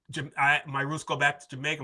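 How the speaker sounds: normal talking voice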